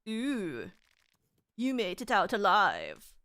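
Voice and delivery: put-on game man in a vague European accent; weird European accent. Game character greeting a survivor, weird European accent. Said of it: "Why am I talking like that?".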